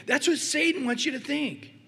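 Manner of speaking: usual speaking voice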